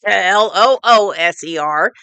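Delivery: letter by letter spelling; spelled out. Shown as a demonstration. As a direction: spell out each letter of looser. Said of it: "not loser".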